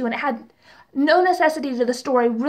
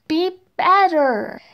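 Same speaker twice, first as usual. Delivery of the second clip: exasperated scolding; 4 semitones higher, much slower than usual. Real, not acted. Scolding the actor's bad acting.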